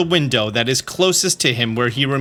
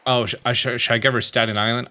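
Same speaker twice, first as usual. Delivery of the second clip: ordinary talk, nothing marked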